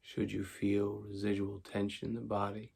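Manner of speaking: calm, unhurried meditation voice with long pauses; guided meditation. Acted. Teacher guiding tension and relaxation before sleep, calm meditation voice. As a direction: talk them softly into letting go.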